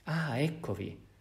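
high, slow glad exclamation; exclaiming. Acted. Bishop greets Jean Valjean before the gendarmes, glad exclamation. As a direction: greet him as if delighted. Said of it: "esclamò".

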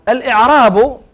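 definition dictated word by word, long pauses; slow dictation. Shown as a demonstration. Teacher dictating the grammarians' definition slowly. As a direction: spell out the definition slowly for the class to write. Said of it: "فقالوا".

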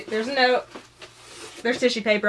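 normal talking voice

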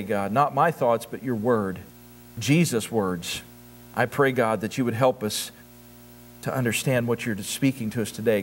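low, even, earnest prayer voice; congregational prayer. Real, not acted. Pastor praying to God before the sermon, reverent prayer.

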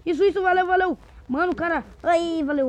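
high, loud burst of relief; excited thanks. Real, not acted. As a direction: cheer the helper on, high and loud. Agradece quem o ajudou. (agradecimento animado, agudo e alto)

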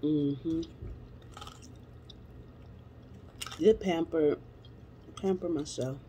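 chewing while talking; muffled through food; mouth full. Real, not acted. Seen 2: Took a forkful at -2; lips closed, chewing while speaking.

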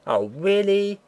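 high, loud exaggerated surprise; mock surprise. Real, not acted. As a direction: overplay the disbelief. Bread turns out bad.

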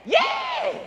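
an eager crowd-style yes; excited yes. Acted. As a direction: jump in with a crowd's happy yes. Answers for the crowd.

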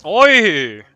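loud sudden yelp; a real jolt of alarm; startled shout. Real, not acted. Sudden death in the game.

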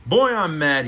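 mad. A narrator's reading. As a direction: punch out a grumble to yourself. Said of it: "he said to himself".

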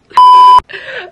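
sudden loud burst of laughter. Real, not acted. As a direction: let a big laugh burst out. Laughs at own joke.

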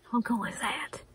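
breathy excited whisper; hushed aside. Real, not acted. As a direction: whisper it to yourself in delight. Excited aside while unboxing yarn.

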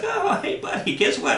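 high doggy talk on oh hey; cooing to the dog. Acted. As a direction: coo at a cute puppy. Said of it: "it's like".

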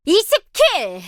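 high, punchy triumphant callout; kill callout. Acted. Announcing a twenty kill streak, triumphant callout. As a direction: shout the kill count proudly.

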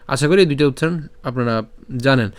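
ordinary talk, nothing marked

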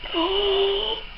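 real gasp of delighted surprise; soft, high intake of breath. Real, not acted. Opening a surprise gift, thrilled.

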